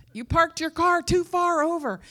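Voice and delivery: high, loud mock scolding; super intense housemate. Intense housemate complains about the parked car, mock yelling. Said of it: "they were super intense".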